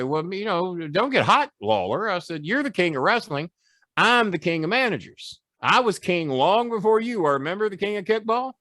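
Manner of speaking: high, cocky heel taunt; taunting promo. Retold or imitated. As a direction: needle the rival king. Retelling his taunts in the feud with Lawler.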